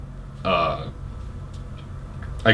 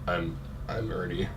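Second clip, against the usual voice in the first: deep, soft Rock impression; Dwayne Johnson voice; 7 semitones lower, 14 dB quieter than usual. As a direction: drop low and soft like the Rock. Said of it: "That, that sounded vaguely Dwayne Johnson-esque".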